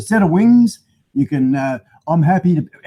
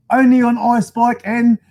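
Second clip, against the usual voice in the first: higher, louder, slow announcer shout; announcer tagline; 6 semitones higher, 5 dB louder, slower than usual. Pretend. Vlogger announcing his show's tagline to viewers, loud and slow. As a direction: boom it like a TV promo.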